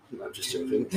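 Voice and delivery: soft unvoiced whisper; hushed joking aside. Real, not acted. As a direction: breathe it out as a throwaway. Joke retraction muttered.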